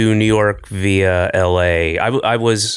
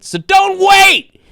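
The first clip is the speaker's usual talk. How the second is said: high, slow, loud cry of don't wait; urgent push; 7 dB louder, much slower than usual. Acted. Host urging listeners in an ad, hammed-up loud cry. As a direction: stretch it out like a hammy pitchman.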